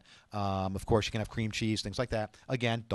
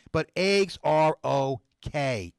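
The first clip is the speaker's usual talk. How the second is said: eggs stretched very slow and leaned on; slow, firm reassurance that eggs are fine; slow emphatic; 4 semitones higher, 4 dB louder, much slower than usual. Real, not acted. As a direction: land each word slowly, stress on eggs.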